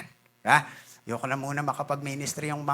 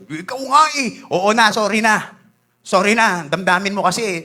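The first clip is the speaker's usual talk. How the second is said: loud, higher, irritated sorry; mock annoyed retort; 3 semitones higher, 6 dB louder than usual. Preacher mimicking someone who resents being corrected, loud and peeved. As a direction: play the sulky one who won't take correction.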